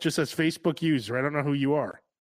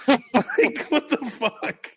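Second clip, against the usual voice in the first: high, laughing disbelief; drawn-out, baffled "what the fuck"; incredulous; 8 semitones higher, much slower than usual. Real, not acted.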